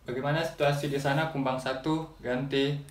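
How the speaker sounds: quiet radio call, ending with ganti; over walkie talkie. Shown as a demonstration. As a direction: speak into the handset like a radio call. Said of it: "saya akan coba untuk menggunakannya sebagai walkie talkie". (bicara lewat walkie talkie, pelan)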